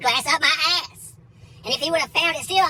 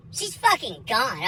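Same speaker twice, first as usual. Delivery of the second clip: ordinary delivery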